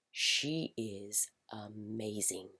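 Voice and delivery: slow, awed whisper. Real, not acted. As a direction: hush it like a secret. Fond aside about Nova.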